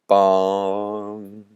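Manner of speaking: long hollow bong; imitated bell toll. Storyteller voicing the bell tolling in the sky, drawn-out bong. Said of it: "A bell tolled in the sky".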